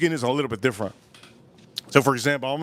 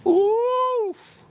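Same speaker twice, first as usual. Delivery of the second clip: high oof. Acted. Reacting to darker skin.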